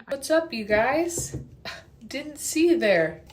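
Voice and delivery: slow, high, loud hammed-up greeting; mock greeting. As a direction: fake surprise at the camera. Mock surprised vlog greeting.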